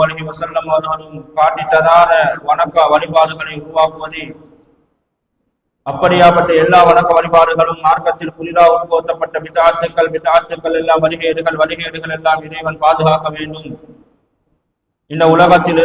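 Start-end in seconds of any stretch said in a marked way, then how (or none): none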